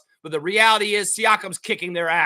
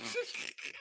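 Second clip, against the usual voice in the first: breathy laugh; 18 dB quieter than usual. Real, not acted. Laugh after rant.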